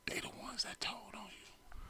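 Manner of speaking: hushed whisper, high and breathless; secret whisper. Real, not acted. Telling a coworker who snitched, whispered.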